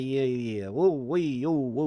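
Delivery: ordinary talk, nothing marked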